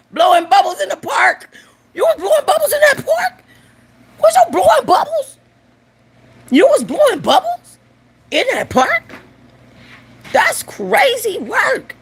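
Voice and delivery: very high, hammed-up squeal; squealing mock disbelief. Joker milks her own punchline, squealing mock disbelief.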